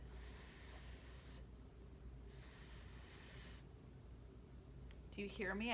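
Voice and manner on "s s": long hissing sss sound; drawn-out s like a snake. Shown as a demonstration. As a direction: hiss it out like a snake. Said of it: "You can make this sound while you trace it".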